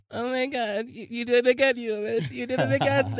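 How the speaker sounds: high-pitched swooning fan voice; gushing fan. Retold or imitated. Fan gushing to the idol after a performance, mock swoon.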